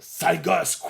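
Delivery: annoyed insult. Acted. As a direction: snap at the brat. Yamcha insults the girl.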